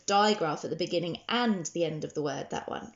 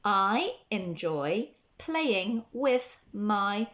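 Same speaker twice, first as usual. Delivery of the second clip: very slow, each word drawn out for writing; slow dictation; much slower than usual. Shown as a demonstration. Teacher dictating a sentence to children, very slowly. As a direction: dictate it slowly so they can write along. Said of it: "the sentence we're going to write today is".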